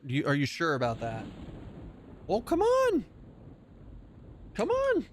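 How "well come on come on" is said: very high sing-out call to a cat; high-pitched pet-calling voice; coaxing a pet. Real, not acted. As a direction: call the kitty over in a high voice.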